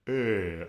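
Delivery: "ö" held long with rounded lips; Swedish vowel demo. Shown as a demonstration. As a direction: model "ö" low and drawn out. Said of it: "need to use your lips".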